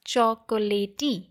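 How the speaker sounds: "chocolatey" as cho-ko-lay-tee, every vowel sounded; spelling read aloud in "chocolatey"; wrong pronunciation. Shown as a demonstration. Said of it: "We don't pronounce it as".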